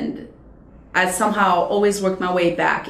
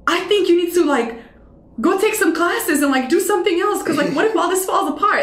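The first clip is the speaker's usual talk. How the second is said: imitating panicked mom; 9 semitones higher than usual. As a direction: mimic a worried mother begging. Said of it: "she was panicking".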